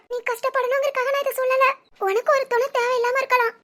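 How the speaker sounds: ordinary delivery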